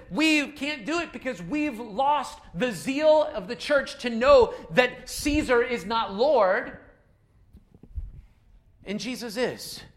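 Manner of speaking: slow, loud, weighty declaration; slowed-down impassioned retort; slow emphatic preaching. Retold or imitated. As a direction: lean hard into each word of the conviction. Chaplain's passionate retort, retold.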